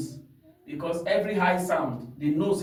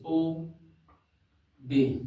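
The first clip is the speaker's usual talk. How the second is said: syllables drawn out. Shown as a demonstration. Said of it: "they are two syllables".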